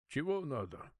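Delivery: a lightly voiced guard, unfriendly; guard's curt question. A narrator's reading. Guard answering the prisoner's knock, lightly voiced and curt. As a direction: answer as a bored guard disturbed at the door.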